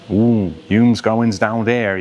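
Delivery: slow Worcester accent with added word endings. Shown as a demonstration. Coach showing extra sounds in Worcester accent. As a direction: drawl it out slowly the Worcester way. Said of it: "adds different sounds to the ends of words".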